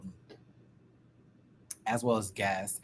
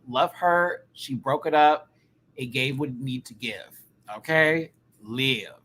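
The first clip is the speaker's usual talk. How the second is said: usual speaking voice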